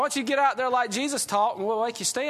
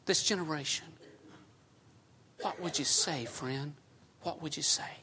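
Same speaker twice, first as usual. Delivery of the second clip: hushed plea; 4 dB quieter than usual. Acted. Preacher pleads with Paul for one truth, fading to whisper. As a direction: lean in and whisper the question.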